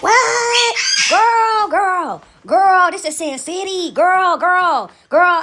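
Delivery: high, drawn-out exclamations, starting with a shriek; scandalized shock. Retold or imitated. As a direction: reel in mock horror at the gossip. Retelling shock at who attends church.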